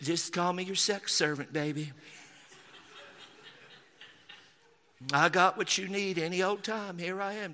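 soft, sweet-talking Southern husband; hammed-up Southern good old boy, coaxing his wife; mock Southern accent. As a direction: play a meek Southern husband offering himself. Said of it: "all of my mockery accents are from the South".

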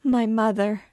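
high, loud cry of distress; unspeakable distress. A narrator's reading. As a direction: cry out to your mother for help. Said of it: "in tones of unspeakable distress".

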